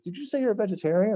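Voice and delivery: high, fast, starstruck question; eager question. Retold or imitated. Teen asks the band guy, high, fast and starstruck. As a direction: blurt it out to your hero.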